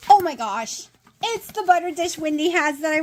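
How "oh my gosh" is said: ordinary talk, nothing marked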